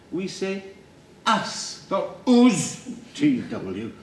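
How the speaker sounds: posh "us", then high, mocked Yorkshire "us"; RP correction. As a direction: correct "us" as a haughty RP teacher, mimicking the short Yorkshire vowel. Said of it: "Receive pronunciation".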